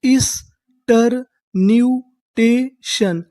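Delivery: ईस्टरन्यूटेशन stretched out, each syllable drawn slowly. Shown as a demonstration. Teacher sounding out the new word for the class, very slow. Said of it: "तो हमारी पूरी meaning क्या बनी?".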